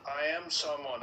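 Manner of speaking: normal talking voice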